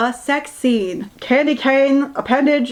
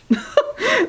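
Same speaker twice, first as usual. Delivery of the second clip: high burst of laughter; a squeaky laugh that breaks out; laughing out loud; 11 semitones higher than usual. Real, not acted. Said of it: "laugh cry".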